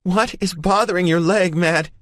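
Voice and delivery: high loud angry woman's yell; yelling back. A narrator's reading. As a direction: yell it right back at him. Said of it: "she yells back".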